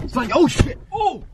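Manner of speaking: shrieking in fright; startled scream. Real, not acted. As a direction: jump out of your skin yelling. Startled by friend appearing.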